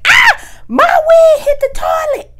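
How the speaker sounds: shrieking high and loud; hollering. Retold or imitated. Telling the empty restroom, a high loud wail. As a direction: wail it in alarm. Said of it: "I'm hollering because I'm like".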